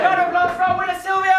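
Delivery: high, loud emcee shout; winner announcement. Real, not acted. Host announcing the winner to the audience, loud announcement. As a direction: rouse the crowd to applaud.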